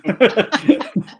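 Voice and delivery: real high burst of laughter; laughing. Real, not acted. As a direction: crack up at the joke. Reacting to the joke.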